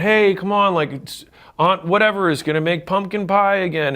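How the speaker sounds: mock cajoling aunt rallying for dinner; lightly imitated pushy relative; coaxing relative. Relatives pushing family traditions.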